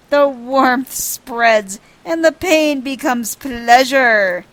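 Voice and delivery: loud, hammed-up blissful moaning nobleman; ecstatic noble. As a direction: groan with overblown relief, slowing down at the end.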